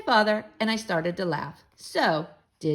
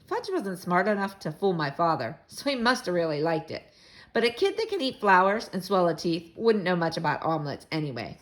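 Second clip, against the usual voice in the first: plain reading voice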